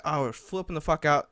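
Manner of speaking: ordinary talk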